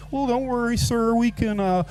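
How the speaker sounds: high-pitched helpful waitress impression; mock-reassure a customer in a high voice; comic high server voice; imitating American waitress. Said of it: "she was like".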